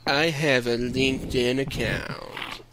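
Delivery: slow cartoon hound drone; very slow hangdog impersonation; Droopy the Dog. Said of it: "really solid Droopy the Dog impersonations".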